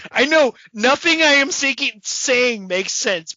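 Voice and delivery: loud, high, drawn out in disbelief; loud incredulous aside; exasperated outburst. Real, not acted. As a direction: blurt it out, incredulous.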